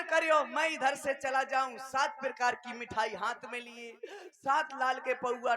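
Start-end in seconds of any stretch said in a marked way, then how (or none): none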